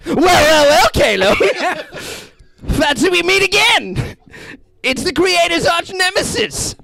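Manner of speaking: hammed-up Guardian. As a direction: greet your old enemy with grand menace. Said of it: "go into this, the Guardian character".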